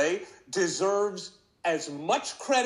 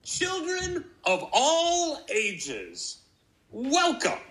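ringmaster; 7 semitones higher than usual. Acted. Circus-style intro to Malik Mania.

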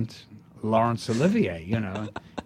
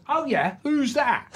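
hammed-up Cockney pub heckler, pitched way up; Cockney fan; 13 semitones higher than usual. Cockney stranger barging into the pub snug, high hammed-up Cockney. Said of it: "He goes".

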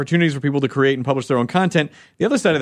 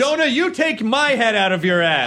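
pundit voice; 8 semitones higher than usual. Pretend. Mock pundit shouting match.

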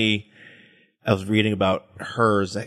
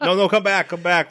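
calling back; 6 semitones higher, 4 dB louder than usual. Pretend. Calling after quitting listeners.

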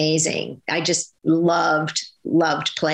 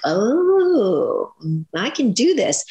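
long swooping high "Oh", then confident; delighted realization; 4 semitones higher, much slower than usual. Retold or imitated. Actress recalling the golden light inside her, delighted realization. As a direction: let a drawn-out "Oh" soar up with dawning confidence.

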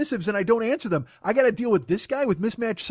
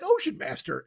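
higher, incredulous echo of the title; scoffing at the silly name, pitched up; mocking repeat; 7 semitones higher, 3 dB quieter than usual. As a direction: repeat it like it's absurd.

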